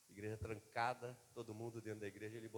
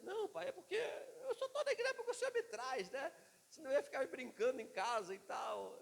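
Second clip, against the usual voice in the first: put-on much higher, laughing little boy voice; child voice; 12 semitones higher, 4 dB louder than usual. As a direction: giggle it out as the candid son. Son's reply, very high, laughing. (voz fininha de menino rindo)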